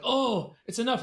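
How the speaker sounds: high-pitched, lightly acted sigh of admiration; lightly voiced swooning crowd. Crowd gazing at the beautiful princess, lightly acted swoon. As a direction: play a touch of lovestruck onlooker up high.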